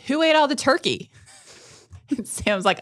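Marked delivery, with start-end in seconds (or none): 0.1-1.1 high, loud accusing demand; retold yell. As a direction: re-enact yelling at the household. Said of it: "yelled, like".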